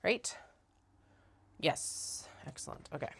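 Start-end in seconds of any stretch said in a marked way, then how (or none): none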